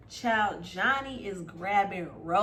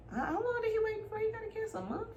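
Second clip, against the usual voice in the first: 1 incredulous question; 12 semitones higher, 6 dB quieter, faster than usual. Real, not acted. Shocked question about the wait.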